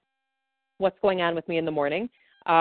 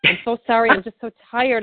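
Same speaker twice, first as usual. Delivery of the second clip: higher, mock-apologetic yawning client; imitating a tired client; 7 semitones higher, 3 dB louder than usual. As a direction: play the sheepish client who can't stop yawning. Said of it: "they keep apologizing".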